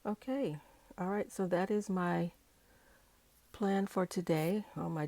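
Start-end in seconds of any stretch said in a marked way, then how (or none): none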